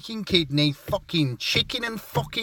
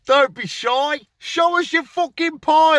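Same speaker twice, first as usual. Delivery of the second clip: high, raised-voice banter; boisterous call; 11 semitones higher, 5 dB louder than usual. Retold or imitated. Regular customer to the bakery lads, high boisterous call. Acts out calling to bakery staff.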